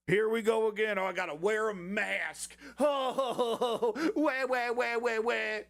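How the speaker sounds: put-on high laughing baby-cry wah wah; mock whiner. Mocking the blinds guy's covid complaints with a high laughing wah-wah. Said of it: "And he goes".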